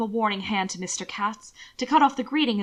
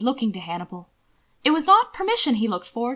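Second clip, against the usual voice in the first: usual speaking voice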